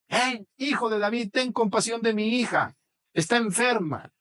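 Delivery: high, slow begging call; shouting plea. A narrator's reading. Mother shouting to Jesus for her daughter, high plea. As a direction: cry out so he turns around. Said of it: "Le grita a Jesús".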